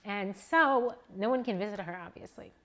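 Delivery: trying not to cry; real tears; choking up. Real, not acted. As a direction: voice breaking, fading to nothing. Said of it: "didn't mean to get so emotional".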